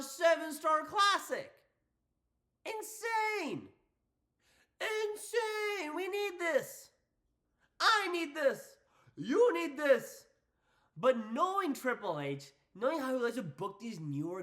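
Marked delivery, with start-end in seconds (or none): 0.0-10.3 high-pitched hype, drawing out the big words; hyped raving. Real, not acted. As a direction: lose your mind over a dream match. Hyped wrestling fan raving about match.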